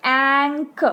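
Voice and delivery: एंक said slowly as one blended sound; glued sound ank. Shown as a demonstration. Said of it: "आप sound को फिर से सुनिए".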